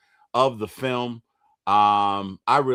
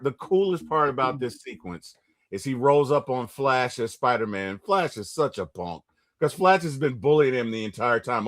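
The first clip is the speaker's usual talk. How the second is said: usual speaking voice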